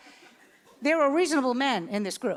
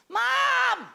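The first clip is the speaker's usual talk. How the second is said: put-on higher alarmed boy voice; son calling from the shower; 10 semitones higher, slower than usual. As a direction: call out in teenage panic. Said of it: "I hear".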